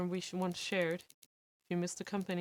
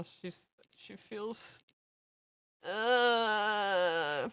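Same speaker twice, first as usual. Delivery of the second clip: on the verge of tears; much slower than usual. Acted. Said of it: "she sounds on the verge of tears".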